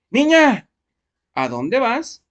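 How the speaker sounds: put-on high, loud startled call to a girl; reenacting a student's surprised reading; surprised exclamation. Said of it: "lo hizo con esa entonación".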